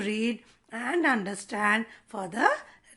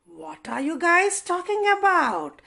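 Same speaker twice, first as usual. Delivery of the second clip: higher, louder puzzled asking; puzzled question mark; 4 semitones higher, 4 dB louder than usual. Acted. Question mark asking the other marks, puzzled and louder. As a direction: sound honestly confused. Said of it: "says the question mark".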